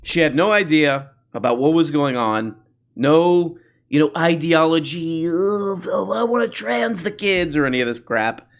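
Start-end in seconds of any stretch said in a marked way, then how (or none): none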